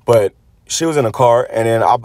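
ordinary talk, nothing marked

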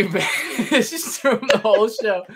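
high, slow, breaking into laughter; helpless giggle mid-sentence; real amused laughter. Real, not acted. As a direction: laugh through the words in disbelief. Amazed at guest's comic disappearance.

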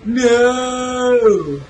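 long, high, hammed-up cry; dramatic wail. Gamer horrified by the evil cabin, hammed-up wail. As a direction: stretch it into a mock-horror wail.

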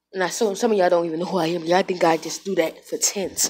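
ordinary talk, nothing marked

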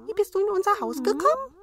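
high, quick curious dwarf; chirpy little dwarf asking. A narrator's reading. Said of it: "sprachen weiter die Zwerge".

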